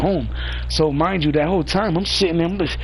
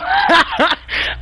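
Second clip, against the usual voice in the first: a burst of high, loud laughter; 12 semitones higher, 4 dB louder than usual. Real, not acted. Laughing at the crew leader, loud and high. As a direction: crack up out loud.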